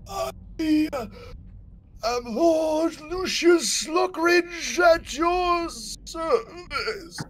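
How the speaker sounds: high, slow, moaning undead noble; zombie lord. Acted. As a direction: call out like a lost old zombie lord. GM voices Lord Sluckridge.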